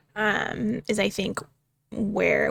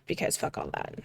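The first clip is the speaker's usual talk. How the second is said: whispered aside; 5 semitones lower, 8 dB quieter than usual. Real, not acted. Muttered defiant aside.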